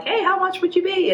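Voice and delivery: high, bright business ask; mock-friendly booking inquiry; sweet inquiring voice. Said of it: "I'm like".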